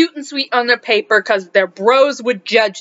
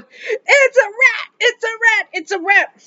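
much higher, slow mock shriek, fading; panicked boy; 10 semitones higher than usual. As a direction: shriek it like the boy, then calm down. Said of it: "he's pointing at this case going".

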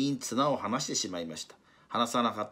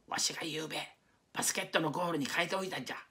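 high, fast secretive whisper; 7 semitones higher, 3 dB quieter, faster than usual. Acted. Takasaki confessing his trick to the team, a high whisper. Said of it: "小さな声で言いました".

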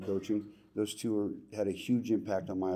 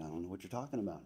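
quick, low-volume mumble, much softer; mumbled young self; 3 semitones lower, 12 dB quieter, faster than usual. Retold or imitated. Retelling his teenage reply to the coach, quick soft mumble. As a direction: toss it off softly like a clueless teen. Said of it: "I said".

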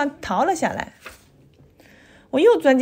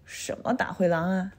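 sleepy mother; 4 semitones lower, 7 dB quieter than usual. A narrator's reading. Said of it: "妈妈揉了揉眼睛".

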